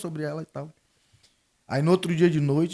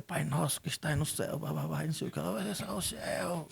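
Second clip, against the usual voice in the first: soft, hushed prayer mumble; murmured prayer; 2 semitones higher, 8 dB quieter than usual. Retold or imitated. Father praying the Our Father over his daughter, hushed. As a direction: mumble the prayer under your breath.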